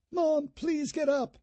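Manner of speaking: put-on high, slow girl's plea; a frightened daughter begs; pleading young girl. A narrator's reading. Daughter begging her mother to wake up, high and pleading.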